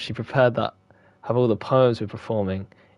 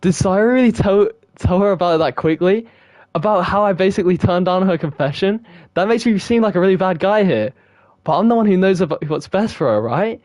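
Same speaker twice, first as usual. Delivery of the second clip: agitated reading; 6 semitones higher, 3 dB louder than usual. Acted. Reading protagonist's thoughts agitated.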